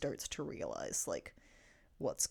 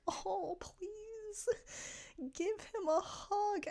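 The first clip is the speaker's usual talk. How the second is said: high, crying plea, slower at the end; tearful plea; much slower than usual. Retold or imitated. Pleading at the screen for Dustin and Steve, high and tearful. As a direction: beg for the hug, voice cracking with tears. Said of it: "I was like".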